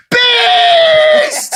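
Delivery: very high, loud ecstatic scream; little boy shrieking at his idol on the phone; kid screaming with joy. Retold or imitated. Son sees Mister Beast.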